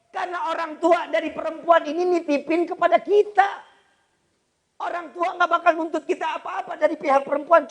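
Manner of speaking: loud, high-pitched preaching; fervent sermon voice; preaching at full force. Acted. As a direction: drive it home to the congregation. Preacher urging from the pulpit.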